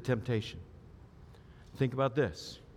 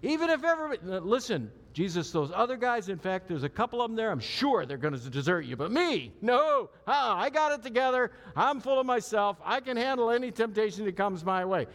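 higher and louder, full of himself; cocky Peter; 7 semitones higher, 4 dB louder than usual. Retold or imitated. Preacher voicing Peter boasting to Jesus, high and cocky. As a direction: brag like you can't be tempted. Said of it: "first time he says it".

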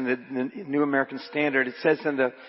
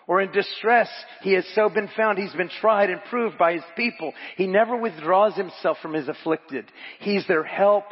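raised, emphatic sermon delivery; preaching; 4 semitones higher, 6 dB louder than usual. Acted. Preacher reads a commentary to the congregation, raised emphatic preaching. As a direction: preach the quotation with building conviction.

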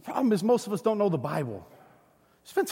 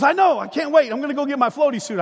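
higher, louder imitation of an eager kid; excited little boy; 6 semitones higher, 4 dB louder than usual. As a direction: play a small son bursting to go. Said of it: "He goes".

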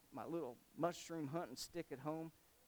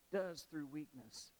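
usual speaking voice

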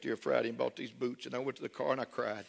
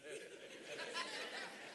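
breathy laugh; 14 dB quieter than usual. Real, not acted. Laughing at the boot store story.

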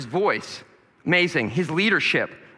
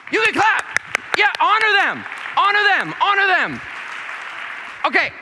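preaching at full force; 5 semitones higher than usual. Acted. Fired-up preaching.

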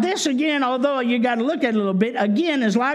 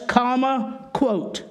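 dictating; slower than usual. Shown as a demonstration. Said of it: "now notice that".

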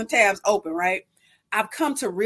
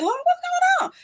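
very high mock-bewildered squeal; acted-out dismay, pitch shooting way up; alarmed cry to God; faster than usual. Woman asking God about sudden acne, comically alarmed.

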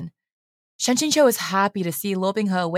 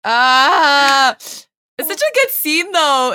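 high, loud squealy gushing; emotional gushing; 6 semitones higher, 6 dB louder, much slower than usual. Real, not acted. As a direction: gush over the scene. Gushing over scene.

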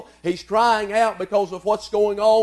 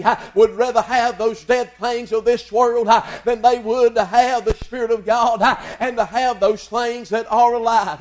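rhythmic preaching, every phrase launched with "uh"; full-force preaching; 3 semitones higher, 4 dB louder than usual. Acted. Preacher pleads with the congregation in a chanting cadence. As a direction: drive it home in a chanting, rising cadence.

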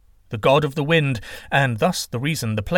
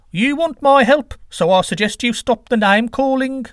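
high, loud protest; offended god in a raised voice; affronted wind god; 12 semitones higher, 6 dB louder than usual. Acted. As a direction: object indignantly, pitched well up. Wind god objects to insults.

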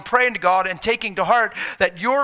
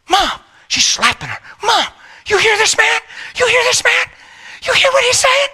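high, loud, giddy daughter; excited daughter; 6 dB louder than usual. Retold or imitated. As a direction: shriek it like an amazed teenage girl. Daughter excitedly slapping her mother.

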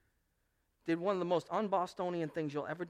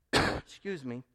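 usual speaking voice

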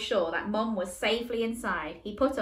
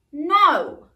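firm, high, loud refusal; stern no; 8 semitones higher, 12 dB louder than usual. Acted. Michael refuses his brother sternly, firm loud no. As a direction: put your foot down. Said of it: "countered Michael sternly".

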